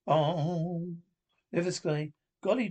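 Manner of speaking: ordinary delivery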